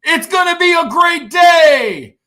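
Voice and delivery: high, loud wake-up cheer; a joyful morning mantra yelled out; excited morning shout. Retold or imitated. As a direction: leap out of bed yelling with joy. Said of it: "pop up on the side of his bed and go".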